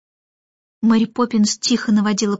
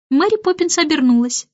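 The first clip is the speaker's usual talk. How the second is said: plain reading voice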